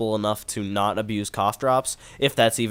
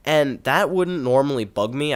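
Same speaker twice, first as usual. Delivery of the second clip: ordinary talk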